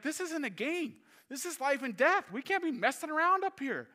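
put-on high, earnest warning to a teen; urgent older voice. To the nineteen-year-old guide, a high earnest warning. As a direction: sternly set the young guide straight. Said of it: "I was like".

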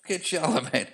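mock shouted song request; calling out to the band from the front. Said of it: "And we were going".

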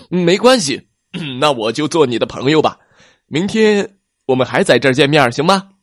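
loud, fast, bright offer; eager friend. Acted. Plesiosaur offering to be T-rex's friend, eager.

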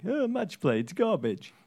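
high, scornful oh, then a dismissive it's garbage; mock a sore loser brushing off the format; sulking golfer. Pundit mimics a beaten golfer dismissing match play. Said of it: "a golfer who just lost a match play round saying".

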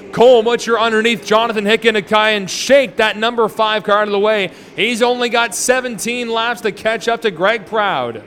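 normal talking voice

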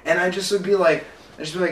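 ordinary talk, nothing marked

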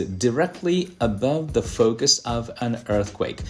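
slow, deliberate English phrase; slow English reading. Shown as a demonstration. Teacher rereads the definition for learners, slowly. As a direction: spell out each word slowly. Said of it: "teacher rereads definition phrase".

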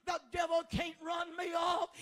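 ordinary delivery

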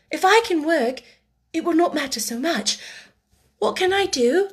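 high, hopeful, relieved question; eager child. A narrator's reading. Orphan asks the headmistress for work, eagerly. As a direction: ask earnestly with rising hope.

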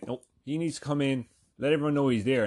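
normal talking voice

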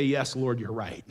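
softly, almost under the breath, in surrender; a hushed, yielding reply to the Lord; humble quiet yes. Pretend. Humble answer to God.

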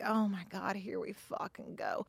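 hushed inner groan of exasperation; private exasperated thought. Acted. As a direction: grumble it under your breath. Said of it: "you're gonna think things in your head".

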